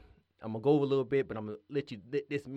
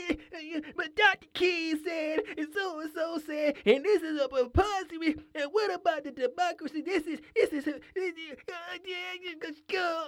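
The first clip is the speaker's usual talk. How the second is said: much higher, whining mock pundit voice; mocking whiny pundits. Podcaster mocking crying pundits with a whiny voice. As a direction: squeak out their complaints like a crybaby. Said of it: "hear all the, the pundits crying".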